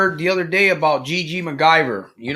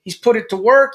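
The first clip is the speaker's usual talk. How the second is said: ordinary delivery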